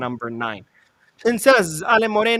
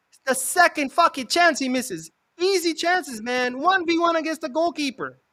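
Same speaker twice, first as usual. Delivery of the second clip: high, heated complaint; frustrated rant; 10 semitones higher, 4 dB louder than usual. Real, not acted. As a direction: gefrustreerd en verhit. Ranting at missed chance.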